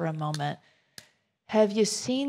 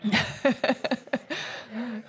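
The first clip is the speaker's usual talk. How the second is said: laughing; 4 semitones higher, 4 dB quieter than usual. Real, not acted. Laughing at Pea Brain question.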